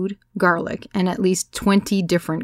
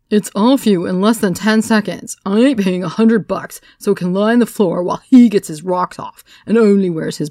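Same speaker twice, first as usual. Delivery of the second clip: louder, brash heckling; rowdy loud rant; 3 semitones higher, 7 dB louder than usual. A narrator's reading. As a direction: heckle from the back.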